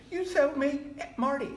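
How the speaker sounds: high, loud mock voice for his friend Marty; retold friend. Old friend Marty reminding [name] of a past remark, in a high, loud teasing voice.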